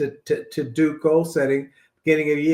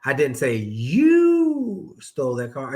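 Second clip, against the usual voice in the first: slow down and stretch the word you; stress on you; slower than usual. Shown as a demonstration. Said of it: "put the emphasis on a different word".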